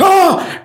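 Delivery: loud, high-pitched "oh!" of alarm; acted shocked gasp. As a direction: re-enact a parent's sudden fright. Father's shock at choking son.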